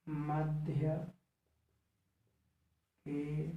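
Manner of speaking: word by word, long pauses between words; slow dictation. Shown as a demonstration. Teacher dictating a rule for students to write, slow and spaced. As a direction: dictate each word slowly so they can write. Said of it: "आप इसको लिख देते हैं".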